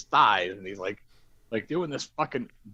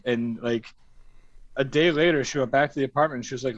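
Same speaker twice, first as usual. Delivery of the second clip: normal talking voice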